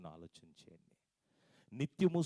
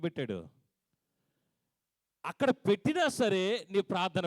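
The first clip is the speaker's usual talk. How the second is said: loud, high preaching with slowed verse words; fiery preaching; 7 semitones higher, 8 dB louder than usual. Acted. Pastor urging the congregation about prayer, loud preaching. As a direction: preach it out with full force.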